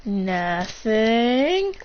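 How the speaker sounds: drawn-out word, lilting upward; feigned innocence. Acted. Sneak caught with the scroll, feigned innocence. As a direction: stretch it out innocently, rising at the end.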